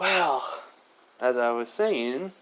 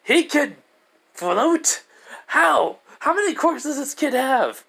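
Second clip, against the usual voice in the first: high, loud amazed outburst; stunned announcer gasping at the kid; shocked disbelief; 3 dB louder, slower than usual. Retold or imitated. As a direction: blurt it out like you can't believe your eyes. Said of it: "President Mike is like".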